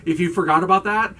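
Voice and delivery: usual speaking voice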